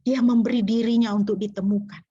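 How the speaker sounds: ordinary talk, nothing marked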